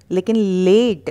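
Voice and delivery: ordinary talk, nothing marked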